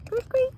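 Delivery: very high squeaky creak; cricket creak. A narrator's reading. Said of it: "The crickets go".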